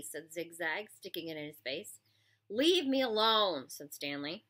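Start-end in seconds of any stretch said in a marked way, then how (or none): 2.5-3.7 slow, louder, annoyed plea; exasperated brush-off; fed-up protest. A narrator's reading. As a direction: drag it out, raised and fed up. Picked-on boy tells bully off.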